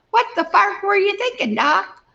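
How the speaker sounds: imagined rebuke to the cardio doc, high and exasperated; exasperated mock outburst. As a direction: toss it up high at the doctor in mock disbelief. Scolding the doctor in hindsight.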